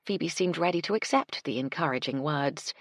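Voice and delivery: plain reading voice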